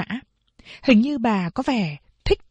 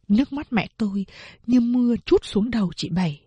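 plain reading voice

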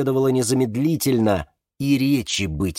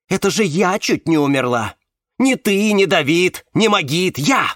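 loud, higher insistence on each name; angry; 7 semitones higher, 5 dB louder than usual. A narrator's reading. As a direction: hammer out the list in indignation. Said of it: "Она злилась". (зло, с напором, повышая голос)

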